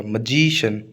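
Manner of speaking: Magician said muh-JEE-shun, the जी held; stress on जी. Shown as a demonstration. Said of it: "जी पर stress आएगा". (Magician के जी पर ज़ोर)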